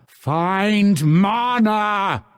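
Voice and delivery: high, very slow stretched cry; commanding shout. Acted. Fighter ordering ally for mana, commanding shout. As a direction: order it with long drawn-out words. Demanding mana, escalating take.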